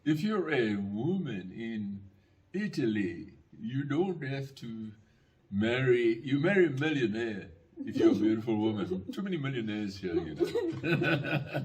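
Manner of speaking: slow, drawn-out mock Italian accent; lightly put-on Italian accent, slow and deliberate. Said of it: "I'm trying to put on my Italian accent".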